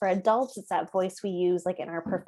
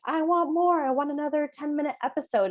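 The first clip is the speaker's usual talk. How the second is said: put-on pleading child; 7 semitones higher than usual. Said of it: "he'll sign like".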